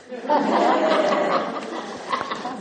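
high, breathy burst of laughter. Real, not acted. Speaker laughs at own punchline.

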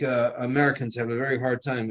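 ordinary delivery

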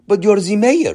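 put-on puzzled, in a thick Israeli accent. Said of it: "in his heavy Israeli accent".

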